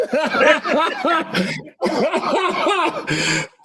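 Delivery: high, full helpless laugh; cracking up after the debate round; real laughter. Real, not acted. Laughing at himself after a heated debate round, high and helpless. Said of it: "look at the look on my face".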